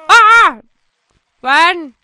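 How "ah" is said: sudden loud shriek of fright; real fright scream; startled scream. Real, not acted. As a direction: scream at the jump scare. Jump scare in horror level.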